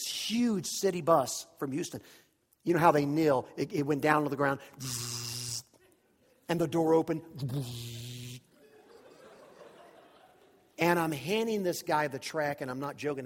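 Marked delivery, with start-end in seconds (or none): 4.8-5.6 low, soft sustained buzz; vocal sound effect of a bus kneeling; imitated bus hiss. City bus lowers to the ground, imitated low buzz. 7.4-8.4 low, soft sustained buzz; vocal sound effect of a bus door; imitated door hiss. Bus door opens beside them, imitated low buzz.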